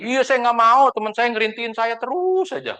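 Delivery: high, drawn-out mock complaint; retold protest. Friend explaining she was pestered into insurance, mock complaining. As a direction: complain in a high voice, stretching the key word.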